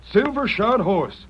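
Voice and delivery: hushed-then-rising wonder; dawning excitement. Acted. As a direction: savour each word in amazement. Realising it's Silver.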